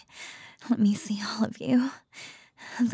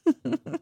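amused teasing laugh; 7 semitones higher, 6 dB louder than usual. Acted. Mocking laugh at trembling lover.

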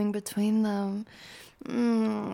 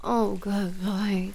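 ordinary talk, nothing marked

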